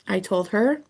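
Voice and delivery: usual speaking voice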